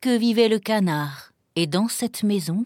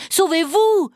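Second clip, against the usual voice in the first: high, loud urgent shout; warning cry; 10 semitones higher, 5 dB louder than usual. A narrator's reading. Pierre warning bird and duck to escape, crying out. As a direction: shout at them to flee. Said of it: "cria-t-il".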